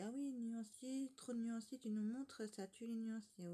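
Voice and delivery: high, slow whiny complaint voice; mocking commenters. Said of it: "il y en a qui disent".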